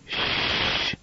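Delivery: sharp whispered shush; a quick unvoiced shh to silence her husband; hushing shh. A narrator's reading. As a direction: hush him before the guests hear. Ellen hushing Brody at dinner.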